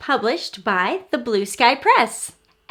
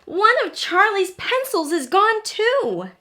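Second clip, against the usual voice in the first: small letter voice; 9 semitones higher, 4 dB louder than usual. A narrator's reading. Letter character speaking.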